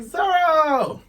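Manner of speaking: very high, loud cry of a name; Luffy. Acted. Captured captain calls to arriving crewmate, high excited cry. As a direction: squeal the name out in delight. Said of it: "Luffy's like".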